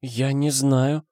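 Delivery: put-on slow, high, lost young woman; high, confused, hesitant voice; bewildered babble. A narrator's reading. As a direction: answer slowly, at a loss. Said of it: "пролепетала Ветрана Петровна, растерянно".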